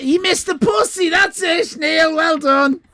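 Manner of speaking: real glee; excited shout. Real, not acted. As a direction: cheer the chatter who got the joke. Praising a chatter who got it.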